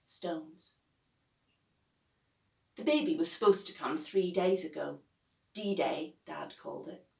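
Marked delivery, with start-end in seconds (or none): none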